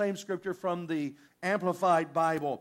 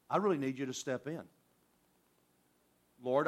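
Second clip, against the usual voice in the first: quiet earnest prayer; 6 semitones lower, 4 dB quieter than usual. Retold or imitated. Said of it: "we give God a very generic".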